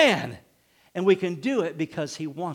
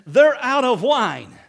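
expectant; 4 semitones higher, 8 dB louder, slower than usual. Retold or imitated. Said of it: "something in her voice".

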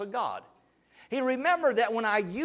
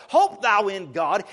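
loud scripture proclamation; 8 dB louder than usual. Acted. Preacher proclaims scripture aloud.

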